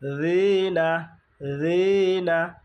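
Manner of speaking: "রিনা" with the রি drawn out long; এক আলিফ টান. Shown as a demonstration. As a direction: slowly stretch the first vowel of "রিনা". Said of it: "রিতে এক আলিফ টান হবে". ("রিনা"-র রি এক আলিফ টেনে)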